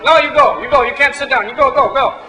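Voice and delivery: put-on high, loud, pushy shooing with a touch of Israeli accent; mock Israeli shooing. Bar owners turning away the drunk, loud mock Israeli shooing. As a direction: shoo him off in a hammed-up Israeli accent. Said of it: "they're from, like, Israel".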